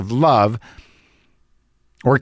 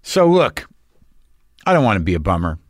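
ordinary talk

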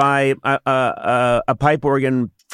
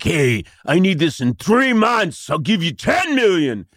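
pushy Los Angeles big-spender voice, drawn out; obnoxious rich guy; 4 semitones higher, slower than usual. Acted. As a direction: throw money around like an obnoxious client. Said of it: "the kinda guy I'm impersonating".

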